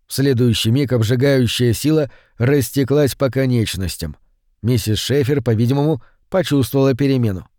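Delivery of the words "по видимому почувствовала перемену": ordinary delivery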